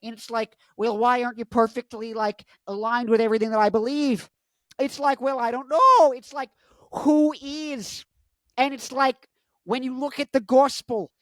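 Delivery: high, strained, halting put-on voice; Jordan Peterson impression. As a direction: agonise over each phrase up high. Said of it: "It's like Jordan Peterson impression".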